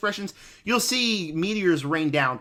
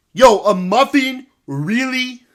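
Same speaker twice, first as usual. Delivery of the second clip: put-on slow, louder, disbelieving LA rapper accent on Yo; [name] accent; 4 semitones higher, 6 dB louder, slower than usual. Dad scolds daughter over a muffin, mock [name] accent. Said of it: "my best [name] accent".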